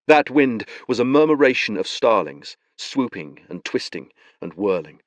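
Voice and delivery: ordinary talk, nothing marked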